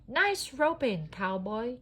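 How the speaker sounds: high, slow, proud praise; cheering. A narrator's reading. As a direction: cheer his rope trick. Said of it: "cheered Buzz".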